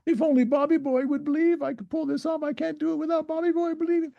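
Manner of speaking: very high, whiny mock voice; hammed-up helpless plea in a squeaky pitch; God. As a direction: put on a silly high voice, lamenting. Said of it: "Is he going".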